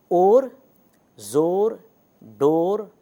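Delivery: ज़ोर and डोर said loud, each with a full long ओ; long o demo words. Shown as a demonstration. Teacher repeats the sample words for students, loud and clear. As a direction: stand each word alone with a round ओ: ज़ोर, डोर. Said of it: "वो ओ है।".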